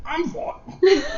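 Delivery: high, hoarse "I'm fine"; raspy high strained voice. Acted. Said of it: "Sorry, I'll do that again".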